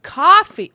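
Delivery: "coffee" with an open ah, KAH-fee; imitated standard COFFEE. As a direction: put on an exaggerated, loud KAH-fee. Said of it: "I can't even say it".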